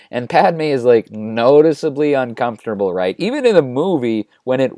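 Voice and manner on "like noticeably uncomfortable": ordinary delivery, nothing marked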